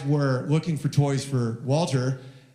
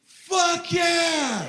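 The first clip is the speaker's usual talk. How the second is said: put-on much higher triumphant kid's cheer; toddler cheering; 13 semitones higher than usual. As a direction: cheer it like a tiny hype man. Son cheering dad.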